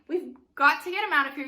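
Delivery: loud, high, quick urging; urgent warning. Shown as a demonstration. Cogsworth tries to bring the group to their senses, urgent and loud. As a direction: press the group to act now. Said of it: "come to their senses".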